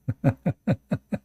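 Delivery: real tickled chuckle; amused laugh. Real, not acted. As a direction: laugh at your own joke. Joking about wife giving ticket.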